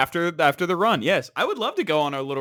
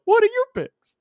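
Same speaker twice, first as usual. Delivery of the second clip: much higher, mock squeaky "What are your picks?"; mocking himself, squeaky; 4 dB louder than usual. Retelling last week to the co-host, mock squeaky high voice. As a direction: replay his own question as a silly high squeak. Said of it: "and I go".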